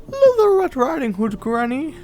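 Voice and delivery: put-on wolf squeaks to pass as the child; wolf faking a child. Wolf at the door pretends to be the girl, fake high child voice. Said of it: "trying to speak like the child".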